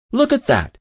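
high, loud burst of excitement; excited exclamation. A narrator's reading. Castwell spotting another rising trout, excited exclamation. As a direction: call it out high and eager.

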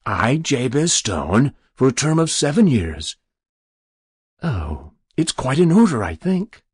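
self-satisfied stranger reciting terms; stranger reading the document. Acted. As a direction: read it out officiously, then pronounce it satisfactory.

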